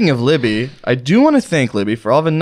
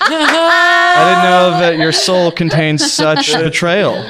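mock betrayal; 5 semitones higher, 3 dB louder, much slower than usual. Mock hurt over comment.

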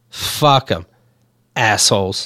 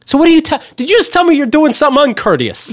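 indignant outrage; 10 semitones higher than usual. Real, not acted. Complaining about the delivery company, high indignant outrage. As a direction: demand it in disbelief.

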